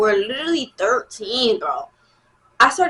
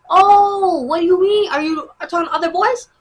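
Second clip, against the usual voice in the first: mock jealous boy, higher and louder; 8 semitones higher, 5 dB louder than usual. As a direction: mimic him whining with suspicion. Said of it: "He would be like".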